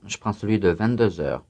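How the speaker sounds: usual speaking voice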